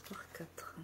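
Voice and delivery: soft unvoiced whisper. Real, not acted. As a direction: whisper the measurement. Muttering measurements to herself.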